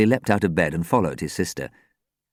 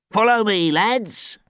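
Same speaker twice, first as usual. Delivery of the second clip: high, loud start, easing off; elderly man's genial order; instructing old man; 4 dB louder, slower than usual. A narrator's reading. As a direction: lead the way as an old caretaker. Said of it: "he instructed".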